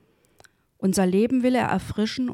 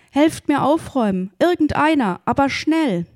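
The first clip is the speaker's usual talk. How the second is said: urgent, higher, louder plea; calling out; 6 semitones higher, 4 dB louder than usual. A narrator's reading. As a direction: call out for help as the story's man. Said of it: "rief er".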